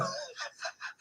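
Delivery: very soft, high wheeze of laughter; wheezy laugh. Real, not acted. Host still tickled by the joke, wheezing laugh. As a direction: laugh almost without voice.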